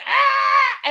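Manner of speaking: mock startled yelp; mock startled shout. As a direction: yelp like a startled man, half joking. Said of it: "the other guy's like".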